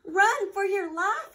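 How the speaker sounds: loud, high frightened yell. Acted. Rabbit warns friends as they flee, loud high yell. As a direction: shout it as you flee in a panic. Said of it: "yelled Rabbit".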